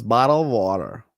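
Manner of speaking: American "bottle of water" with a flapped t in "water" and a full r at the end; "water" as "wadder", with the r sounded; American pronunciation. Shown as a demonstration. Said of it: "or the American pronunciation".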